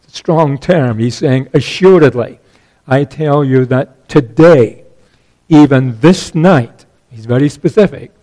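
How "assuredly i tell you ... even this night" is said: loud, slow, emphatic warning. Acted. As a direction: lean hard on every word. Voicing Jesus' emphatic warning.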